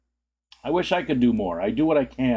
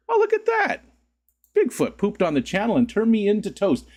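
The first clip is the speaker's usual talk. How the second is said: high-pitched playful exclaiming; high mock amazement; 10 semitones higher than usual. Streamer tells chat he got turned into toast, high mock amazement. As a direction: squeak it up high in mock wonder.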